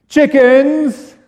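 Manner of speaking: high, loud mocking cry of Chickens; hammed-up giant taunt. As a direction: jeer it down at the cowards. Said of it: "calls out to them".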